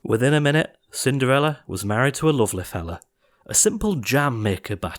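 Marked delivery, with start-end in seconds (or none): none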